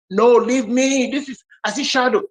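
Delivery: acted-out indignant cave dweller; stubborn refusal.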